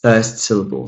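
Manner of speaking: ordinary delivery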